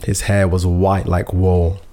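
slow quote. Retold or imitated. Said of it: "he says".

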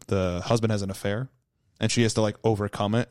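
ordinary talk

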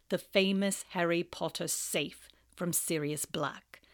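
drip with contempt; sneering Snape. Acted.

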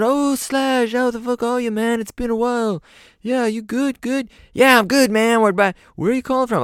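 very high cheerful British voice; delighted to hear from an old friend, up high. Retold or imitated. [name] chats with [name].

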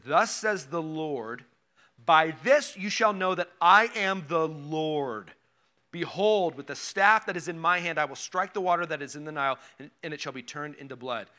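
solemn scripture read. Acted. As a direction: read it out like the voice of the Lord. Reading scripture aloud in sermon.

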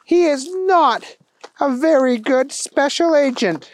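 very high, measured woman official; head police chief. Acted. Head chief releasing Wendell to Thunder Kitty, high and earnest. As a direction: deliver a pleading verdict in high voice. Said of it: "The head of the chief of the police".

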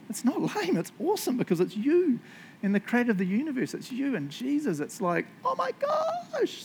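very high-pitched, gushing excitement; hyped-up disbelief. Acted. Preacher rebutting someone who calls their story lame, high excited protest. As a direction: climb way up, stretching out the gosh.

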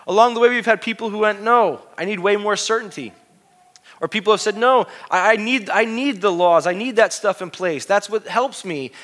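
loud, high, fired-up sermon; full-force preaching. Acted. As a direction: preach it out to the whole room.

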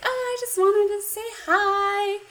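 put-on high, sweet girlish voice. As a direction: play the cute waitress lightly. Girl character quoted in recap.

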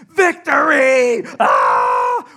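triumphant scream. Retold or imitated. Buddy surfacing after the jump, screaming in triumph. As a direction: roar it like you just survived. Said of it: "he's screaming".

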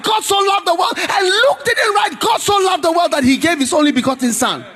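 high, shouted revival preaching; preaching at full force. Acted. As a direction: pound each question home at the congregation.

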